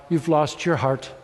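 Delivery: usual speaking voice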